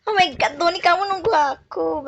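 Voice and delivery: delighted your friend waited; gushing with joy; excited. Real, not acted.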